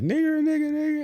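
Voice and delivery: high, sneering singsong slur. Retold or imitated. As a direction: taunt it in a high mocking sing-song.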